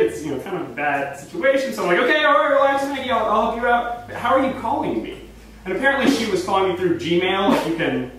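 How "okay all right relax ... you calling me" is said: raised-pitch, flustered attempt to calm someone; urgent reassurance. Friend soothing a crying caller, urgent and high. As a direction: talk down a panicking friend, voice lifted.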